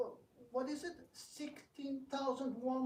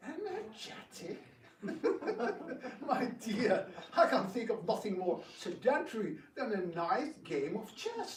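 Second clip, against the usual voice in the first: laughing her worry away, louder; amused laugh; 4 dB louder than usual. Acted. Rukmi laughing off his sister's worry. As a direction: scoff kindly with a laugh.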